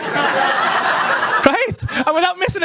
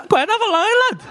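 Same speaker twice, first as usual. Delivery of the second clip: pushy dealer offer; 9 semitones higher, faster than usual. Retold or imitated. Driver offering coke, acted quote.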